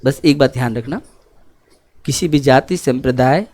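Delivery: low, softened, earnest counsel; solemn exhortation. Real, not acted. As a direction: drop the voice and impart it gravely.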